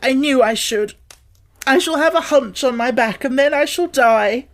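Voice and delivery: high, loud despairing wail; terrified wail. Acted. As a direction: wail it in terror. Boy sobbing in terror.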